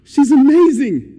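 loud, high burst of admiration; imitating an excited, amazed onlooker. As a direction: rave about her at full volume. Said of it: "just so excited".

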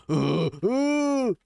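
high drawn-out "uh" sounds; imitated noise. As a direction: imitate it with rising, high hoots. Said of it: "He's go".

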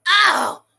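loud, high "oh" of shock; shocked outcry. Real, not acted. Streamer landing on the paused frame, loud shocked outcry. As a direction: cry out sharply at the sight.

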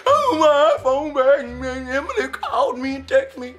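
slow, drawn-out wailing lament; crying over phone. Acted. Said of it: "Stop crying".

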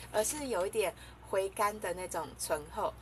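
ordinary talk, nothing marked

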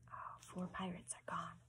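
real whisper, very soft; whispered aside. Real, not acted. Reader tallies the lost pirates, whispered. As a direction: whisper a comment on the story.

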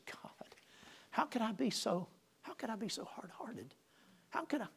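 hushed, unvoiced plea to God; soft whispered prayer of remorse; whispered repentance. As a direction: whisper it, ashamed and broken. Weeping prayer in back room.

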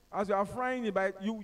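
ordinary talk, nothing marked

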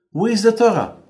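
high, loud demanding question; Satan demanding. Acted. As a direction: demand it like an impatient accuser.

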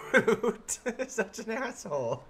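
laughing through the words, high and loud; cracking up while teasing. Real, not acted. Laughing at a chat joke.

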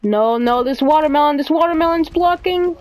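increasingly high, frantic protest; flustered cry at an obstacle; rising panic. Real, not acted. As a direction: climb in pitch as you get stuck. Obstacle coming in game.

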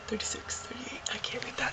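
soft unvoiced mutter; whispering. Real, not acted. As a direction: murmur it to yourself. Squinting at thermometer in cold.

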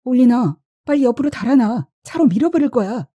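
high, urgent shout to get away; shouted warning. A narrator's reading. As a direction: yell a desperate warning to someone in danger. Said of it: "물러서라고 소리쳤다".

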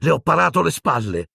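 high, indignant outcry; shrieked. A narrator's reading. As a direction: cry it out high, betrayed. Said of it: "strillò Hermione".

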